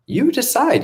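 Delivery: "You decide." flung up high, stressing "You". Shown as a demonstration. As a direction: throw it back at them, high and pointed.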